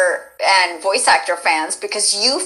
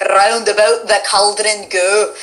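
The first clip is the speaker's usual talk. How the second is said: slow, rolled-r Scottish incantation; theatrical Scottish accent, drawn out; Scottish witch; slower than usual. Shown as a demonstration. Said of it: "I learned a little bit of Scottish".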